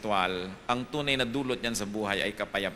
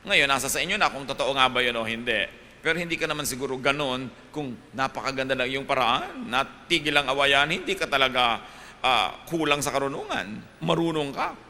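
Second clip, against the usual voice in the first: ordinary delivery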